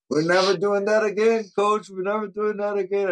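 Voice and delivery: high-pitched mock whine of sorry players; pleading players. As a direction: beg the coach in a squeaky voice. Players begging coach after punishment run.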